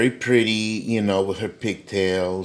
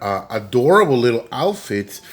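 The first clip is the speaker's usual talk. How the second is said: ordinary talk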